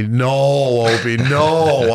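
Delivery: long, drawn-out mock dismay; dismayed disapproval. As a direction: imitate a teammate slowly saying no. Teammate disapproves of his new tattoo.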